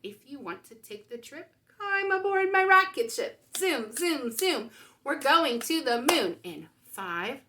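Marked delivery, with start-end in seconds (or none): none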